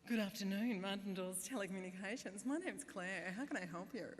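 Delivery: sing-song call-centre patter, swooping tune; a rote greeting with an exaggerated lilt; altered phone greeting. Bored call-centre worker after months of repeats, affected sing-song voice. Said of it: "I started answering the phone like this".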